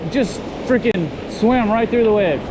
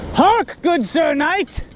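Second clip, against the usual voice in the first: knight voice; 8 semitones higher, slower than usual. Pretend. Challenging a man in armor.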